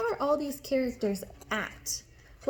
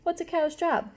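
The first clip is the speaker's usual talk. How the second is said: usual speaking voice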